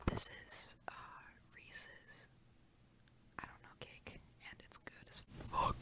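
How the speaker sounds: soft unvoiced whisper. Real, not acted. Describing the cake to viewers, in a whisper. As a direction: whisper the food review. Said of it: "we're just gonna whisper in this bitch".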